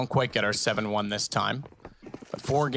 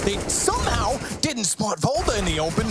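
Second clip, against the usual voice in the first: higher, faster play-by-play as the clutch unfolds; rising excitement; 9 semitones higher, 3 dB louder than usual. Real, not acted. Caster to viewers, building excitement over the clutch. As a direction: call the action with mounting tension.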